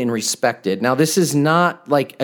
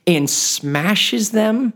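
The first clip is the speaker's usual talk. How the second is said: and smashes them drawn out very slowly, pitched higher; horrified emphasis; 6 semitones higher, much slower than usual. Real, not acted. Preacher to congregation, horrified at the psalm, slow and high. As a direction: let and smashes them land slowly in disbelief.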